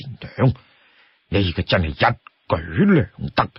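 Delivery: lightly voiced sworn brother giving considered support; Lu Junyi. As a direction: reason it out calmly, a little low. Voices Lu Junyi advising leader.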